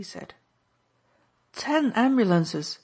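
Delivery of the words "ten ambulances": higher, louder delighted society lady; thrilled, effusive exclamation; excited cry. A narrator's reading. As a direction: gush with sudden delight. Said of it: "she cried".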